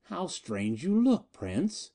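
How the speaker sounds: mock him with cruel delight; sardonic. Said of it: "Miko's sardonic voice came again".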